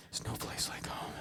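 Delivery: unvoiced, very soft whisper; whispered incantation. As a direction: whisper it like a magic wish. Acted magic wish to be elsewhere.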